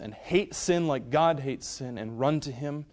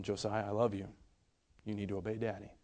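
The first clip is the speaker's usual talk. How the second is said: soft, low reassurance; quiet, loving but firm; tender father; 4 semitones lower, 10 dB quieter, faster than usual. Retold or imitated. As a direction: speak gently and low to a small child. Father soothing his disciplined son.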